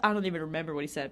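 normal talking voice

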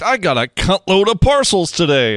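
put-on high-pitched call-out in own accent; mock proud shout. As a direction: announce it high to the workplace. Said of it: "I'm gonna just shout that shit out at work".

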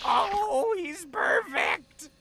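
high, drawn-out adoring coo; gushing delight. Acted. New owner adoring the tiger, gushing.

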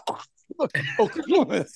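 "Okumus" with a throaty, spit-spraying start; spitty Gaelic pronunciation. Shown as a demonstration. Host showing spit needed for his name, throaty "Okumus". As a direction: say "Okumus" like an old person's sneeze. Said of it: "some spit's gonna come out when you say".

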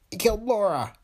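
high, squeaky little man; piping squeak of a small tough. A narrator's reading. Dillon accusing Foster in a high squeak.

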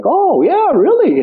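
high mock Cockney; Jason Statham. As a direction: imitate a friend's high Cockney reaction. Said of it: "you know, Cockney".

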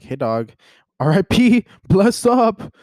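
mock RIP shout-out ending in a laugh; mock tribute. Imagining wrongly mourning a living author, mock tribute. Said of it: "I'm over here being like".

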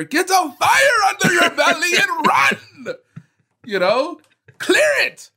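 high, loud hammed-up pep yell with a laugh; mock coach shout. Coach firing up a player, hammed-up high shout.

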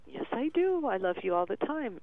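put-on eager toddler reply, high on yes I do; a hint of a small child; little son's voice. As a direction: lightly voice a two-year-old insisting. And he'd go.

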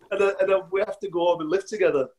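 ordinary talk, nothing marked

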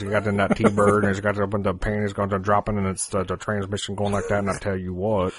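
low-key rambling mumble on one pitch; Boomhauer. Shown as a demonstration. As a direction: run the phrases together in a monotone Southern ramble. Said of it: "do a good Boomhauer".

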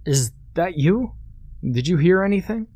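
high, quick, anxious questions; frightened voice. A narrator's reading. Ryan hiding in the toilet stall, high frightened questions. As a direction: ask it in a nervous rush.

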